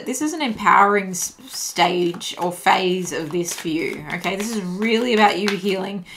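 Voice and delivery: usual speaking voice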